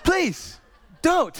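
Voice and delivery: squeaky laughing please; begging child. Retold or imitated. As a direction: beg like a little kid. Kids begging not to be spanked.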